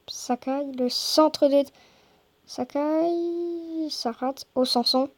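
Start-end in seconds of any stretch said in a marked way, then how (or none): none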